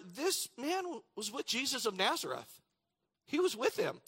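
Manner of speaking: put-on high, pointed servant girl's voice; accusing servant girl. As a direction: lift into a lightly voiced young girl pointing him out.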